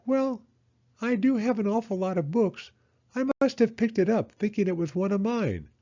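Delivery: high, sheepish owl voice; Owl's voice. A narrator's reading. Owl admitting he picked up the notebook, high and sheepish. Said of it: "Owl said".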